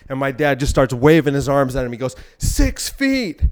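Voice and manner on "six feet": high-pitched warning yell, imitating dad; mock yelling. Dad warning kids on bikes in the park, mock yelling. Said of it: "He starts yelling".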